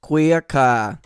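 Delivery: laughing Boston accent. Shown as a demonstration. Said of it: "queer in a Boston accent".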